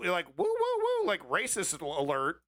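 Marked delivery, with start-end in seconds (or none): 0.4-1.1 very high, louder mock hooting woos; mocking woman's woo. Mocking the speaker's opener, high squealing woos. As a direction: ham up her squealing woo, woo, woo. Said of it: "listen to how this woman delivers this message".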